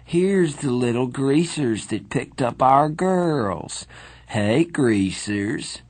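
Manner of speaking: put-on slow drunken sneer; a drunk bully mocking two kids; taunting. A narrator's reading. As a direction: drag out the insult. Drunk Soc taunting greasers.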